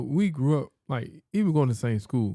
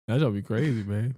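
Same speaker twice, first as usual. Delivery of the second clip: normal talking voice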